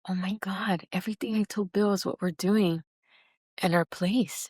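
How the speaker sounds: hushed, amazed whisper; awed whisper. Retold or imitated. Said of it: "and I was like".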